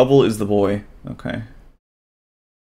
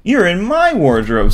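put-on a bit higher boyish voice; lightly voiced boy; 8 semitones higher, 3 dB louder than usual. Bubble answers the creature, lightly boyish. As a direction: play the boy a notch up.

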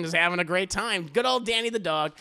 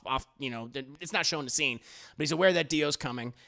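normal talking voice

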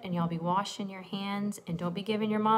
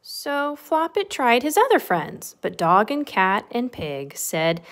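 lilting, animated read-aloud; bouncy storybook narration; story reading to children; 3 semitones higher, 9 dB louder, slower than usual. A narrator's reading. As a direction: read a rhyming picture book to little ones. Reads picture book to children.

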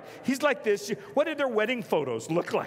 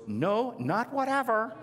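high, slow mock protest; protesting lady; 5 semitones higher, slower than usual. Lady behind me refusing to let it go, protesting. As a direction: object slowly, pitched up in disbelief. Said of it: "The lady behind me goes".

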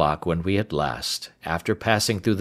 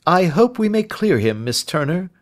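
lightly voiced detective, raised pitch; courteous sleuth to a client; reassuring Holmes; 10 semitones higher than usual. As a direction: offer measured hope.

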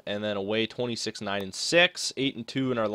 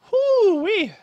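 excited whoop; 9 dB louder than usual. Real, not acted. Reacting to eleven goals against.

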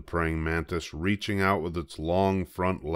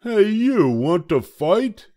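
high, loud, grumpy little bug; a tiny bug spoiling for a fight, high and loud; grouchy ladybug; 8 dB louder, slower than usual. A narrator's reading. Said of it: "the grouchy ladybug said".

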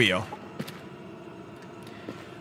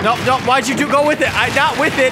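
high, loud panicky protest; panicked yelping; 10 semitones higher, 5 dB louder, faster than usual. Real, not acted. Player reacting as it goes wrong, panicked yelping.

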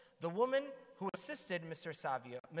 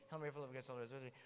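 softer, tossed-off recitation; rushed, fleeting prayer; 5 semitones lower, 9 dB quieter, much faster than usual. Shown as a demonstration. Preacher shows how we pray it carelessly, softly rushed. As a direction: rattle it off without care. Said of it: "the Hail Mary so fleetingly".

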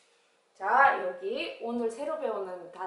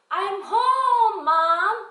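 high, loud homecoming shout; child arriving home; 9 semitones higher, 10 dB louder, much slower than usual. Acted. Child coming in greeting mom, high loud call. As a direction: ring it out for Mom to hear.

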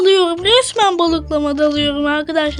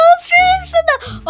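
teacher praise; 10 semitones higher than usual. Acted.

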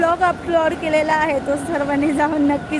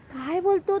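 pitched up high in wonder; amazed repeat; 7 semitones higher than usual. Shown as a demonstration. Repeating her son's words to viewers, amazed and high. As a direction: repeat it, astonished.

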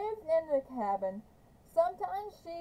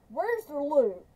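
gruff demand; 2 semitones higher, 6 dB louder than usual. Acted. Trout threatening Kate with rifle.

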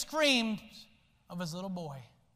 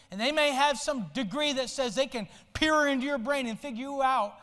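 normal talking voice

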